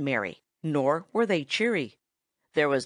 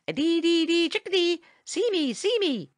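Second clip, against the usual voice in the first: high, chirpy bird song; cheery chickadee call; 12 semitones higher than usual. A narrator's reading. Tommy Tit calls to Farmer Brown's boy, cheerily. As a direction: call it out merrier than ever. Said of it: "called Tommy Tit".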